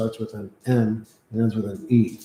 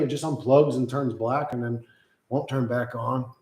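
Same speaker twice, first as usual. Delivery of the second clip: ordinary talk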